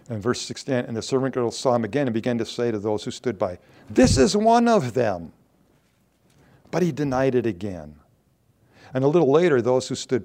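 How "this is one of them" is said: accusing. Retold or imitated. Servant girl accusing Peter.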